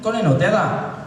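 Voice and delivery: high-pitched mock Glaswegian; thick Glaswegian. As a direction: repeat it in a thick Glasgow accent. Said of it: "they got thick Glaswegian accents".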